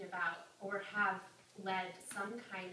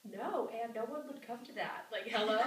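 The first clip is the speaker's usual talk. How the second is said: mock disbelief, ending on a loud hello; sassy sorority girl; scoffing refusal; 4 dB louder than usual. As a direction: brush it off as obvious. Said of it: "she's like".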